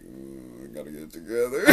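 low, quiet, dazed stoned voice; imitating his stoned self, low and quiet; stoned mumble. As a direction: mumble it like you're wasted. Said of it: "I'm sitting there just like".

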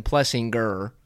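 "Plessinger" with a hard G, "Plessing-ger"; hard-G pronunciation. Retold or imitated. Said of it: "like, the hard G sound".

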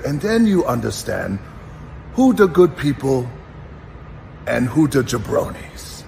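imitated accent, spaced out with pauses; mock foreign accent, building to the punchline; Iron Sheik. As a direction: teach the lesson in his thick accent. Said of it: "he had this great accent".

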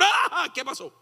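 high startled squeal; a woman caught off guard; flustered surprise. Pretend. Woman greeted by a man, high flustered squeal.

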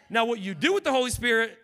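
loud, high preaching voice; preaching at full force. Acted. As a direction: preach it hard to the church.

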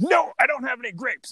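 fed up, third time asked; frustrated. Retold or imitated. Maitre d' losing patience with the duck, mock frustrated. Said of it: "the maitre d' is getting frustrated".

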